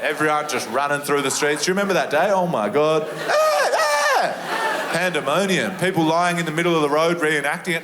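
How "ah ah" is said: high screaming goat yell. Retold or imitated. Comedian imitating the viral goat, high bleating scream.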